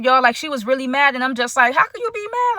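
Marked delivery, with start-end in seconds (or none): 1.7-2.6 sharp high disbelieving question; can't believe she's mad at you; incredulous. Retold or imitated. To her upset friend in the shower, incredulous high question.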